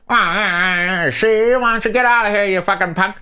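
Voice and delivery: lightly done mobster with a cigar; a hint of an old gangster telling someone off; tough-guy impression. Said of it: "he goes".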